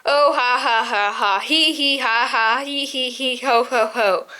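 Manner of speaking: ha ha, hee hee, ho ho, running through the laughs; gloating owl laughing at the mouse's wager, laughter; owl breaking into laughter. A narrator's reading. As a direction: laugh off the bet as too easy to lose. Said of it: "The owl broke into laughter".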